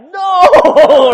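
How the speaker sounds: high, loud, hammed-up no; loud protest. Dan denying he is proposing, loud comic protest. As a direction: cry out a panicked mock denial.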